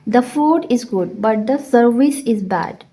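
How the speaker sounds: very slow, each word laid out, the t of "but" dropped; slow teacher's model sentence; slow demo reading. Shown as a demonstration. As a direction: dictate it slowly to the class with "but" reduced. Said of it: "टी silent हो जाता है".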